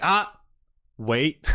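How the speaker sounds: sudden loud, high "Ah", then a stop; mock alarm. Voicing her survival instincts coming in, mock-startled interjection. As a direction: imitate a startled instinctive halt.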